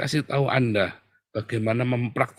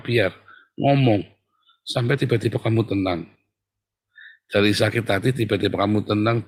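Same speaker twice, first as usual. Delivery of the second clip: usual speaking voice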